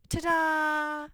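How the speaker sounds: a teacher's triumphant finish for the class. Acted. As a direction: present it like a magic trick finishing. Teacher announces the task is finished.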